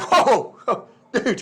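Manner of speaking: incredulous laugh breaking into the words; laughing disbelief. Acted. America reacts to Genet, laughing.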